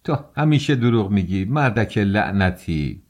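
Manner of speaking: ordinary delivery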